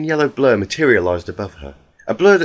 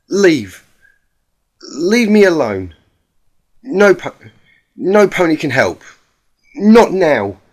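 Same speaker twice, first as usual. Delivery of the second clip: loud, higher anguished refusal; desperate shouting; 6 semitones higher, 8 dB louder, slower than usual. Acted. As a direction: cry it out before collapsing in sobs, much louder. Rainbow Dash breaking down.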